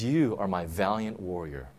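low, soft and gentle; quiet tender affirmation; tender assurance. Acted. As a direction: speak it like a loving father. The Lord's gentle word to coward.

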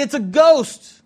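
lightly acted fright; mock terrified. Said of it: "They're terrified".

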